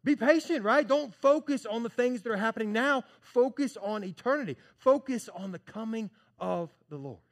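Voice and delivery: loud, high-pitched whoa breaking into the grumbling; James cutting in. Acted. Preacher voicing James stopping the complaining church, shouting high. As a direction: jump in and shout them down, then urge patience. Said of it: "James is gonna step in and say".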